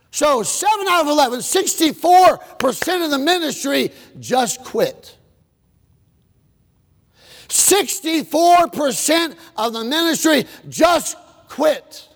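high, slow, hammering; full-force preaching. Acted. Preacher hammering the congregation, full force. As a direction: drive it home.

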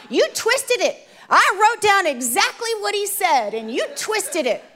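high, emphatic mock vindication; exaggerated scolding I-told-you-so; mock husband's told-you-so. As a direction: play a husband crowing that he was right. Acts out husband's triumphant told-you-so.

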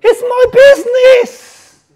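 a loud, high, drawn-out cry of ownership; loud indignant outburst. Acted. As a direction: proclaim it as a sudden realisation. Outburst correcting own complaint.

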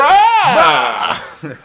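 high, very loud bellowed imitation; mock booming bellow; booming Salah shout. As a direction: roar it like a hammy epic actor. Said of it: "I love Salah's voice".

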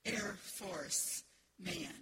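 usual speaking voice

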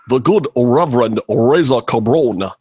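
put-on preacher-like impression, words leaned on; Jesse Jackson. Host naming a viewer as the good Reverend, mock Jesse Jackson impression. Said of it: "in my best Jesse Jackson".

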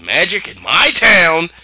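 mock outraged ruler, drawn out; imitating a wizard's slow outraged cry; righteous indignation. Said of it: "full of righteous indignation".

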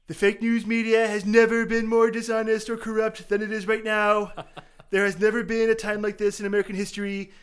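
hammed-up Trump reading a tweet; Donald Trump impression. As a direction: puff the lips and talk like a mock tough guy. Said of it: "try my Donald Trump impression".

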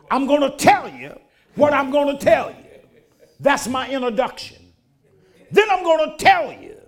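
rising, measured pulpit cadence with punched words; full preaching. Acted. Pastor preaching the gospel to his congregation, fervent. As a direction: preach it, drawing out the big phrases.

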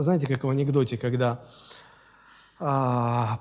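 ordinary talk, nothing marked

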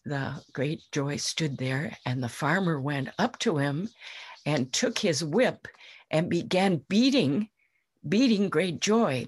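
ordinary delivery, nothing marked

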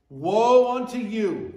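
slow, loud "Woe unto you", "Woe" leaned on; solemn emphasis. Shown as a demonstration. Preacher stressing the phrase to the church. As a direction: pronounce it like a verdict. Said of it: "This is a big phrase".

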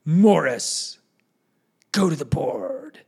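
put-on loud, rasping command from an old priest; booming, drawn-out classroom order; stern old priest. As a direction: call out a pupil like a feared teacher. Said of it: "he would petrify you".

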